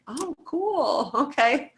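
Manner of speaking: high, breezy fake-interested "oh cool"; mock impressed. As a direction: act politely impressed by something you don't get. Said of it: "and I'm like".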